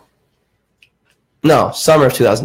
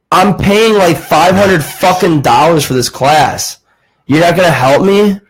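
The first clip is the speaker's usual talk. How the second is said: raised, higher voice, indignant and pushing back; like you can't believe he won't help; frustrated outburst; 6 semitones higher, 3 dB louder than usual. Retold or imitated. Student to math teacher, refusing to be brushed off, indignant and raised.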